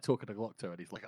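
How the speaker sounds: usual speaking voice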